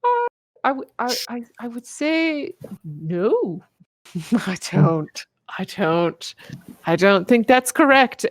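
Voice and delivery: high, hesitant, halting protest; a hint of a frightened young woman; weak timid voice. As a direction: stammer it out weakly, scared to object. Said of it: "Viola very weakly just kinda goes".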